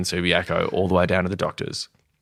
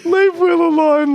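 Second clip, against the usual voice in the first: high, slow tearful plea; Woody in tears; 6 dB louder than usual. Retold or imitated. Colleague in tears begging the boss in the meeting, high tearful plea. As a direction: imitate a weeping man begging, drawn out high. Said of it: "Woody bursts into tears".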